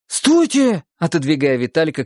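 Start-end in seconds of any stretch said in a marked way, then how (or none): 0.1-0.8 higher urgent shout Стойте!. A narrator's reading. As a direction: yell to stop him. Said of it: "крикнул Валька". (крик)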